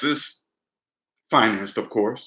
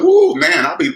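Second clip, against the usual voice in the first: mock impressed whoop; 6 dB louder than usual. Mock impressed at big salary.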